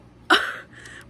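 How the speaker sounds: sudden loud laugh at her own mishap; burst of laughter. Real, not acted. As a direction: break into a loud real laugh. Laughs at running out of tape.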